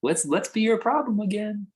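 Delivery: high-pitched, needling inner voice; nagging thought's voice. Acted. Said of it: "It's gonna keep trying to say".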